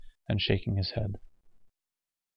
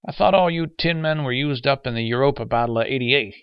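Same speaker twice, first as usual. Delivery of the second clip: put-on higher-pitched old man's grumble; complaining; 5 semitones higher than usual. Old man grumbles to the robot guiding him, complaining. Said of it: "he complained".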